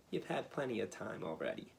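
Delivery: plain reading voice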